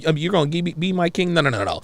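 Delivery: ordinary talk, nothing marked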